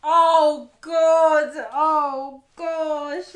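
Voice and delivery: loud, high cry of oh God; horrified exclamation. Real, not acted. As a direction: shriek out in horror. Listener horrified reaction.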